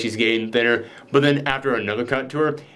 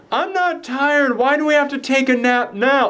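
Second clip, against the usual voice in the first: put-on whiny child; 11 semitones higher than usual. A kid off the school bus complaining about nap time, high childlike whine. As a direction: play a sulky kid. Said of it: "one of them goes".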